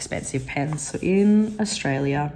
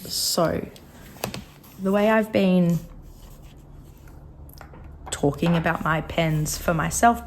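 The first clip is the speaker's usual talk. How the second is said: usual speaking voice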